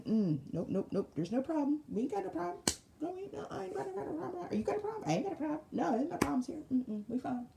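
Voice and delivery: high, meek backpedal, nothing to see here; backing down. Acted. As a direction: squeak out that there's no problem at all. Backing down before the Most High.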